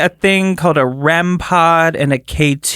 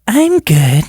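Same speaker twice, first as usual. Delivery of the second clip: higher, louder ghostly "I'm good"; 4 semitones higher, 4 dB louder than usual. Retold or imitated. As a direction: mimic the spirit voice brightly. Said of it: "What does the voice sound like?".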